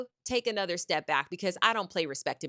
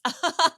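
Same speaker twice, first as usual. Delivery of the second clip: sharp laugh; 10 semitones higher, 7 dB louder than usual. Real, not acted. Laughs at her own threat.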